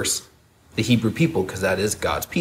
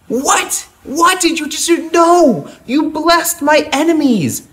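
high, indignant cry of disbelief; outraged shout; 5 dB louder, slower than usual. Acted. Angry king shouting high at Balaam who blessed his enemies. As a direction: shriek at the prophet who betrayed him. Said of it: "Balak is standing there going".

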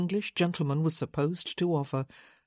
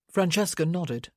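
ordinary delivery, nothing marked

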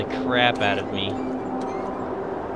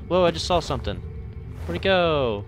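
startled, louder and higher; scared horror game streamer; 6 semitones higher, 4 dB louder than usual. Real, not acted. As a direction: jump at something you glimpsed. Startled by something moving.

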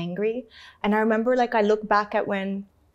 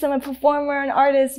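normal talking voice

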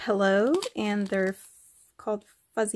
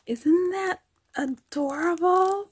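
higher, delighted question; gushing; 8 semitones higher than usual. Real, not acted. Asking viewers about the bows, higher delighted gush. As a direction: invite viewers to swoon with her.

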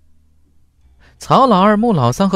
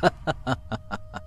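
a long, breathy laugh trailing off; 3 semitones higher, 11 dB quieter than usual. Acted. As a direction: let out a long scornful laugh. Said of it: "发出了一声长笑". (一声长长的冷笑)

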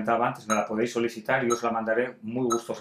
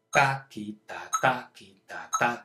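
triplet syllable count; 3 semitones lower, slower than usual. Shown as a demonstration. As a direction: tick out triplets on the beat. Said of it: "Para el tresillo utilizan".

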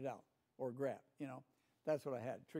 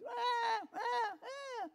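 much higher, louder mock baby wail; imitating a baby crying; 5 dB louder, much slower than usual. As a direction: wail like a newborn who wants attention. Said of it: "and he's crying".

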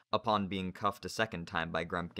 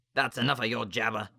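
snapping teacher; 3 semitones higher, 6 dB louder than usual. Acted. Narrator voices Grumpkin.

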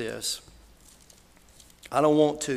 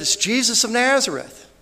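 high, loud reply over a crowd; retold shout, pitched way up; acted shouted answer; 10 semitones higher, 9 dB louder than usual. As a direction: call the answer back loudly. Acted answer shouted to blind man.

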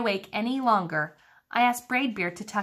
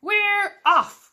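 high, loud cheering yell; crew shout; 12 semitones higher, 5 dB louder than usual. A narrator's reading. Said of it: "we all shouted".

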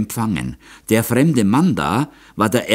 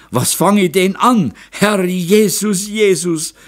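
loud lament; 12 semitones higher, 5 dB louder than usual. A narrator's reading. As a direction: wail helplessly at the disaster. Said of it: "klagte Moser". (lautes, hohes Klagen)

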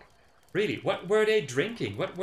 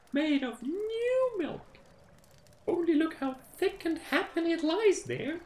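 high, coaxing mother voice; mother answering. Acted. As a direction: soothe the hungry children. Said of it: "When a pancake heard this".

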